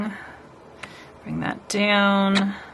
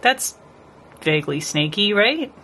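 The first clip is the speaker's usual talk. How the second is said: ordinary delivery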